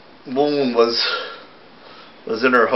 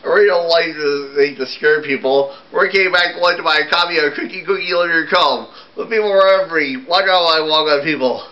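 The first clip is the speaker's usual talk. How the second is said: a ghoul's lament, pitched higher; a sad little ghoul, sung-spoken and higher; lightly voiced ghoul; 6 semitones higher than usual. Ghoul telling everyone he scares people, lightly voiced and higher.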